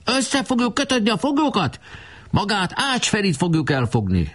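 put-on very high, excited boyish boasts; boastful boy voices. A narrator's reading. Boys boasting about capturing the enemy, very high and eager. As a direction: boast like keen young soldiers.

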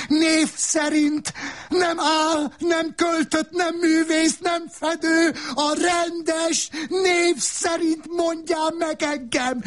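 the wife screaming to be called by her name; shouting. Acted. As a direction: scream every word demanding to be named. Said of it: "Üvöltötte".

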